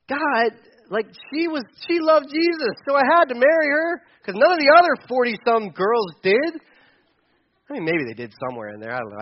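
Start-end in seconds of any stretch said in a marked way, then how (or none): 0.1-6.6 higher, louder pleading complaint to God; indignant retold plea; mock protest. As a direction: protest in disbelief, pitched well up. Acted protest to God at breakup.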